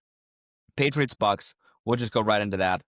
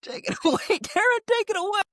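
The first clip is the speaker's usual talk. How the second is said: much higher mock announcer squeal; squeaky handoff. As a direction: toss it over in a silly high voice. Hands off to co-host.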